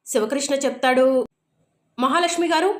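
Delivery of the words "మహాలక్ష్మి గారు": higher, mildly raised call of her name; a mild mock shout up the stairs; calling out loudly. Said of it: "గట్టిగా అరవటంతో". (మహాలక్ష్మి గారు అని కొంచెం గట్టిగా పిలుపు)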